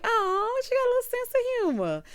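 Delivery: delighted. Retold or imitated. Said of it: "I'm like".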